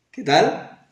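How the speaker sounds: ordinary talk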